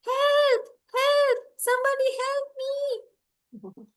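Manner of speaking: high, drawn-out storybook call for help; cry for help. Acted. Dragonfly in a puddle calls to be rescued, high cry for help.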